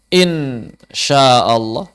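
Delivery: insya Allah said slowly, each sound drawn out; contoh lafal. Shown as a demonstration. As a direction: drawl out "insya Allah" carefully as a model. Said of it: "Jadi kita mau nulis". (ucapkan "insya Allah" pelan, tiap bunyi dipanjangkan)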